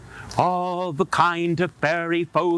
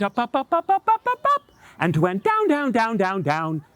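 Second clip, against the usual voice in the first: rising jump sound; 7 semitones higher, faster than usual. Acted. Rabbit jumping sound effect.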